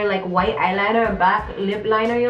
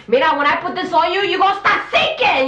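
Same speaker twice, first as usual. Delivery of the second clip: high, loud, fast brassy threat; mock-threaten like a tough New Yorican sister; sassy Puerto Rican girl; 9 semitones higher, 5 dB louder than usual. Comedian imitating a loud friend threatening someone, high and brassy. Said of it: "she was like".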